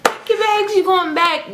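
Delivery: high mock Jamaican grandma scolding; high-pitched dramatic grandma. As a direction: order them out in grandma's squeaky-high accent. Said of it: "her high-pitched self".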